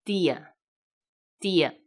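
slow "dia", weak closed final a; closed unstressed a. Shown as a demonstration. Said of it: "要发闭音". (慢速示范：非重读的 a 发闭音)

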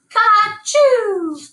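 high, loud braying "Ka-choo"; the donkey brays a sneeze; brayed sneeze. A narrator's reading. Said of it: "the donkey brayed".

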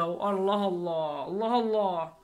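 low, drawn-out exasperated Allah Allah; weary muttered complaint; exasperated sigh. Real, not acted. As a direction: groan it out, fed up with the caller. Annoyed at repeated phone calls.

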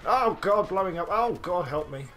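high, loud mock panic; panicked plea. Real, not acted. Pilot whose ship is blowing up, high panicked plea. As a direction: beg for help as you explode.

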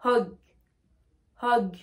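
Hug said "hog", one at a time; rounded o in each Hug; O vowel. Shown as a demonstration. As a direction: model the o in Hug, with pauses. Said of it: "on va bien prononcer le O".

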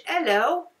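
cheery mock British "Hello, how you doing, matey"; lightly put-on British accent, friendly. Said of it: "like I'm British".

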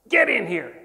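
loud imitated order, falling at the end; a firm first demand to come inside; retold command. Teacher orders the stranger into the classroom, loud.

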